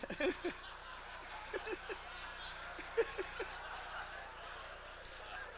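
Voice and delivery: long wheezing silent laugh; breathy laugh. Real, not acted. Preacher cracking up at his own joke, wheezing laugh.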